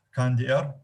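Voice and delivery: normal talking voice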